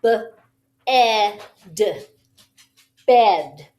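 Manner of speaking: "Bed", then "B-E-D" sound by sound, then "Bed" blended; segmenting bed. Shown as a demonstration. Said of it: "do that two times".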